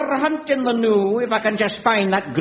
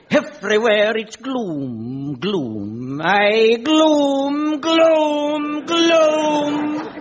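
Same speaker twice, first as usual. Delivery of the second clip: gloom repeated, climbing higher and higher; dour gloom; 4 semitones higher, much slower than usual. Acted. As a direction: moan each gloom a notch higher. Dour Scot building on gloom.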